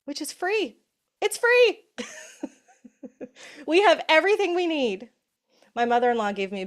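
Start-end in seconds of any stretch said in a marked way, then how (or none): 0.1-2.5 high, loud delighted exclaim; excited. Real, not acted. Delighted it's free. 3.7-5.1 high, loud enthusiastic; excited. Real, not acted. Excited follow-up.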